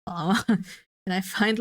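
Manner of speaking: ordinary talk, nothing marked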